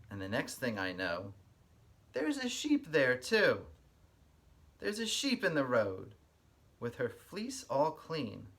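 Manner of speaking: lively storybook read-aloud with a sing-song lilt; reading to children. Acted. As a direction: read it like story time for little kids. Reads picture book aloud.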